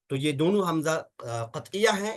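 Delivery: ordinary delivery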